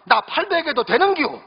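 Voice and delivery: country bride asking in Gyeongsang dialect. Retold or imitated. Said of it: "경상도 신부가 또 말합니다".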